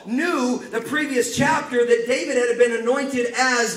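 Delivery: fired-up preaching. Acted. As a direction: preach it hard to the congregation. Preaching at full force.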